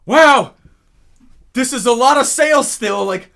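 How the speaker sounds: loud, high wow of disbelief; amazed client. Retold or imitated. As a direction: sound floored by the sales. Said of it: "they'd be like".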